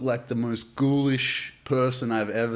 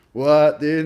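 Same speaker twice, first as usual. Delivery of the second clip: retold excited disbelief; 5 semitones higher, 8 dB louder than usual. Retold shocked reaction.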